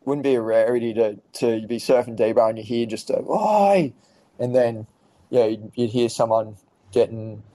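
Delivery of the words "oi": high, drawn-out call; imitated surf yell. Surfers yelling at each other at D-Bar, imitated shout. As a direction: call out high as if across the water.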